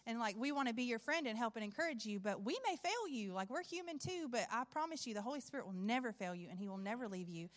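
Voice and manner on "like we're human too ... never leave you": normal talking voice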